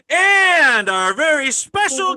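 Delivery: high, loud, drawn-out guest announcement; stretched-out showman's intro, booming and high; announcer introduction. Pretend. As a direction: build up the guest's name like a ring announcer.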